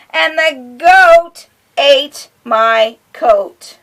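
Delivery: sing-song picture-book reading; reading to children. A narrator's reading. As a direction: lilt it for a little listener.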